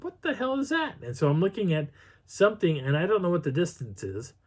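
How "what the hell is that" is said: startled disbelief. Retold or imitated. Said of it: "And I'm like".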